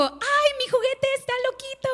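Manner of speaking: put-on high, excited little boy; squeaky kid voice for the son's toy; child voice imitation. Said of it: "Y mi hijo".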